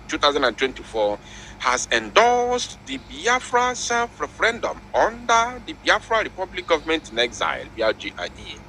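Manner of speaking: high, urgent news read; breaking news. Retold or imitated. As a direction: announce it like a headline. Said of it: "He said".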